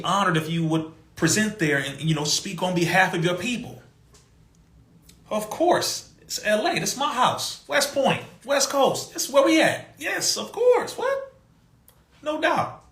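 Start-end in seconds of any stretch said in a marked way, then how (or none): 5.3-12.8 high, eager, playing along; squeaky overeager agreement, hamming it up; mock eager yes. Mocking his own eager reply to the organizers, high and keen.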